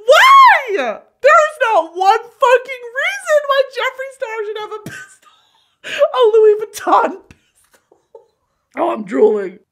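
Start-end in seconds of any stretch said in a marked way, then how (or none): 0.1-8.0 high, loud, laughing disbelief; incredulous squeal. Real, not acted. Laughing high disbelief at [name]'s pistol. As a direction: squeal it like you can't believe it.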